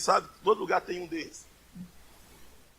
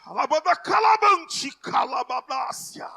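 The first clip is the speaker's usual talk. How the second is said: shouted, much louder and higher sermon climax; full-force preaching; 7 semitones higher, 10 dB louder than usual. Acted. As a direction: preach it at the top of your voice. (pregação inflamada, gritada e bem mais alta)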